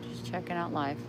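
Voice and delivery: usual speaking voice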